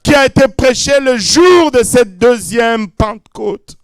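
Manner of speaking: loud, rising pentecostal preaching; hammering sermon cadence; full-force preaching. Acted. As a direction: preach it out to the crowd with fire.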